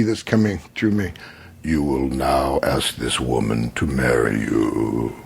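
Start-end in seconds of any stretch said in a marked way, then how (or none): 1.6-5.1 very low, slow, booming-oracle monotone; imitated voice of fate, deep and droning; commanding inner voice. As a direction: deliver it as a deep otherworldly command, words drawn out. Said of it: "then I hear through this energy".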